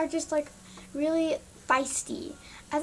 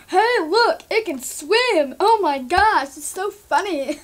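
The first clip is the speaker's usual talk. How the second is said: mock glee at a swimming pet; mocking silly owners; 3 semitones higher, 7 dB louder, slower than usual. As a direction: ham up a giddy careless owner. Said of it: "and they're like".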